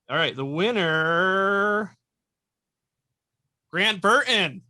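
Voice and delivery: loud, drawn-out winner reveal; hyped giveaway announcement. Real, not acted. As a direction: announce the winner like a game show. Spinning wheel, announcing prize winner.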